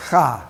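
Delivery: "cha" with a throat-scraped ch; throaty ch sound. Shown as a demonstration. Teacher showing the class the letter's sound, "cha" with throaty ch. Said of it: "we pronounce that w-, letter as".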